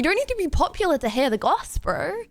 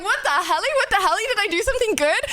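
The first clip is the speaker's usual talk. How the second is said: higher, squeaky voice for the puppy; voicing the dog; 8 semitones higher than usual. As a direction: play the confused happy puppy. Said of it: "So he always is like".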